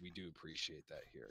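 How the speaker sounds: low, soft ogre tavern keeper; low and hushed, like a gentle giant introducing himself; Grunt, the nighttime tavern keep, low and soft; low ogre barkeep. Acted.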